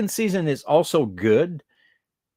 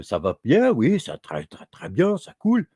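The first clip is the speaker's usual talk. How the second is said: lilting put-on accent of French from France; European French accent; 3 dB louder than usual. Shown as a demonstration. Quebecer showing how the French from Europe talk, European French accent. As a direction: imitate a European French speaker, pitch swinging upward. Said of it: "that's more like the French from Europe".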